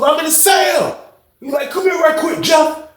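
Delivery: high, shouted calls between cells; imitating inmates calling across the tier; calling back and forth.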